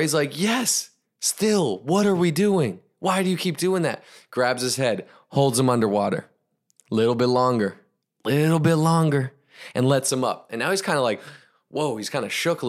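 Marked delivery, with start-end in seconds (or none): 0.4-4.0 high-pitched, insistent questioning; playing a fed-up student; exasperated protest. Student protests repeated dunking. 8.2-9.3 "little bit longer" pitched higher and held; "little bit longer" raised, as if straining underwater; strained suspense. A narrator's reading. Repeat stretches the suspense higher.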